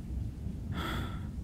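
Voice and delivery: long breathy sigh, all air; weary sigh. Acted. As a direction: let out a tired, put-upon sigh. Captor exasperated with captive.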